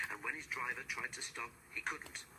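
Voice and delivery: usual speaking voice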